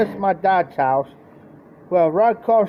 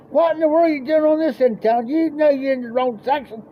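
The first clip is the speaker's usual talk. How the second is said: higher, fast, incredulous; exasperated dad; 9 semitones higher, faster than usual. Retold or imitated. Dad scolding Burl for being on the wrong end of town, fast incredulous. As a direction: pile on the disbelief.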